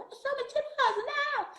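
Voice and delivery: very high, squeaky protest; mock indignant policeman. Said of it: "Ele falava". (policial indignado, voz muito aguda)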